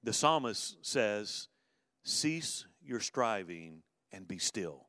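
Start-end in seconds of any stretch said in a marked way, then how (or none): 2.0-4.9 slow, measured scripture quote; slow scripture reading. Acted. Pastor reading the psalm to the congregation, slowly. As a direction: lay each word down slowly.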